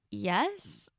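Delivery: hesitant questioning yes; unsure, as if asking. Acted. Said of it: "It sounded like a question".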